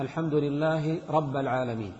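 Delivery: "رب" said rabba, with a in place of i; fatha on ba. Shown as a demonstration. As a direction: recite the slip رَبَّ in "رب العالمين". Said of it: "بفتح الباء".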